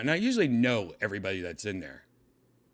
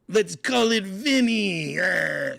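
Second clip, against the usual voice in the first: high, loud, drawn-out mock cheer; big goofy mock celebration; over-the-top proud parent; 10 semitones higher, 7 dB louder, much slower than usual. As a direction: blare it out slowly, hammed-up. Imagined parent naming new baby.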